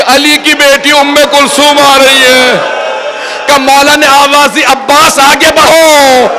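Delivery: high mourning narration building to a drawn-out wail; lamenting preacher. Acted. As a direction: climb steadily from heightened telling into anguished cries.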